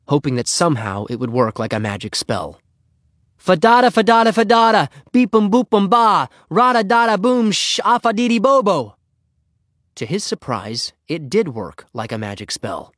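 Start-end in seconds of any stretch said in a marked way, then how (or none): 3.4-9.0 loud, high rhythmic yell; club yell. A narrator's reading. Henry desperately tries the club's magic words, chanted yell. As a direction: belt out a secret club cheer like a spell. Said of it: "Henry tried the club yell".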